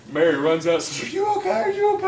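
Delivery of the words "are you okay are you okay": much higher, louder worried wife voice; imitating his wife checking on him, high and loud. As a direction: squeak it out like the panicked wife. Voicing his wife running out.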